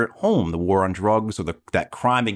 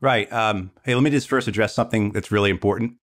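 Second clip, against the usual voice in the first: normal talking voice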